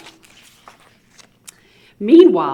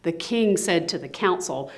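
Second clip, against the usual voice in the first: ordinary delivery, nothing marked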